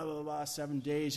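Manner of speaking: usual speaking voice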